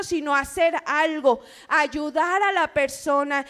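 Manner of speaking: usual speaking voice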